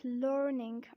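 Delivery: American "learning", R clearly sounded; American accent. Shown as a demonstration. Teacher contrasting accents for learners, American "learning". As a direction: put the R into "learning", 'lurr-ning', American style. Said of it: "in American accent we say it".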